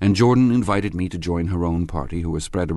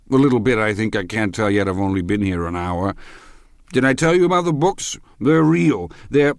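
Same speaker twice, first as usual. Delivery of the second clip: raised tipsy ramble, building high; ramble, then burst out excitedly like a drunk; loud drunken chatter rising to high insistence; drunken man; 5 semitones higher, 6 dB louder than usual. A narrator's reading. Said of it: "I've been drunk for about a week".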